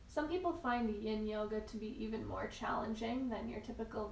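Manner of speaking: soft, unhurried yoga teacher voice with long pauses; calm, measured breathing cues; guided yoga meditation. Real, not acted. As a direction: guide them gently into stillness, leaving room to breathe. Leads a yin yoga practice.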